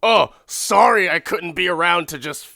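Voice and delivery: hammed-up grumpy husband ranting; mock rant. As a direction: fire off a bitter complaint.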